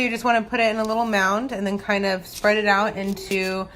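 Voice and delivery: ordinary delivery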